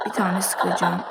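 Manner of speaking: ordinary delivery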